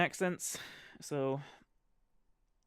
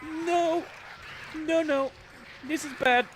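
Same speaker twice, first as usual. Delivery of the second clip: high, loud, frantic string of no's; real gamer panic as the monster closes in; yelping no over and over; panicked no's; 12 semitones higher, 4 dB louder, slower than usual. Real, not acted.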